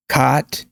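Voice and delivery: "caught" as "cot", open AH, rising; unrounded AH in "caught"; cot, AH vowel. Shown as a demonstration. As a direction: say "caught" like kaht, tune up. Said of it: "repeating four or five times".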